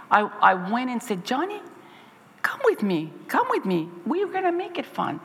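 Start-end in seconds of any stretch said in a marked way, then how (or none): 1.2-1.6 high, coaxing call of his name; soft sing-song call; sweet call. Retold or imitated. Teacher coaxing a shy boy. 3.3-3.9 higher, gentle coaxing repeat; lightly sung invitation. Retold or imitated. Coaxing boy to class. 4.0-5.2 high, soft, encouraging; sing-song reassurance; coaxing promise. Retold or imitated. Coaxing boy to class.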